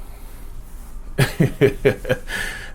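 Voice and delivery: long, breath-only chuckle; real wheezy run of chuckles; amused laugh. Real, not acted. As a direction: laugh to yourself at the idea. Amused by imagined video recommendation.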